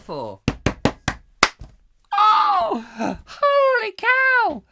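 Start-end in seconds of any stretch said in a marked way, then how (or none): 2.2-4.6 high shouted oh holy cow; astonished cry. Real, not acted. Realising what Bram is short for, high astonished shout. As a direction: yelp it out in sudden discovery. Sudden realisation about name.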